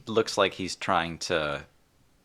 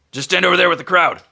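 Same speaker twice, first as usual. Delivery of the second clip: loud, higher marshal's call; directing call; 13 semitones higher, 13 dB louder, faster than usual. Acted. Half-orc sending the group to the crowd, directing call. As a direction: project it across the field.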